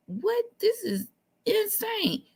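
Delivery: high-pitched stunned disbelief, slow; shocked disbelief. Retold or imitated. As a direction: squeak it out in shock. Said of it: "I was like".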